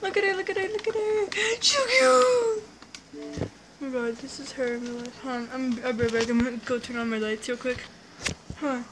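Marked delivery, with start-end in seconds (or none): 0.0-2.6 very high, squealing gush; excited squeal. Real, not acted. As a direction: squeal with delight at something adorable. Squealing over cute girl on screen.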